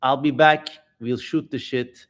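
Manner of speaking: ordinary talk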